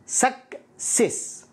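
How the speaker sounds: سكسس drawn out very slowly, suk then sess; slow careful success; slow pronunciation demo. Shown as a demonstration. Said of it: "السي الأولى نطقت كاف".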